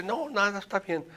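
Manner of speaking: high-pitched, placating brush-off; retold people-pleasing reply; complacent patient. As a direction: imitate a patient insisting all is fine.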